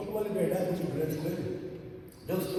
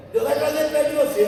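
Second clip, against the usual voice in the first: preaching burst; 7 semitones higher, 9 dB louder than usual. Acted.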